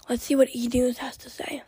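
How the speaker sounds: voiceless whisper from a lost voice; whisper, voice gone. Real, not acted. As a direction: whisper it, no voice left. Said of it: "oh my, I can't talk".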